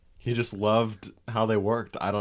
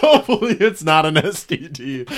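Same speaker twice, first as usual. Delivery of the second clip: laughing; 7 semitones higher, 11 dB louder than usual. Retold or imitated. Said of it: "repeating the punchline, laughing".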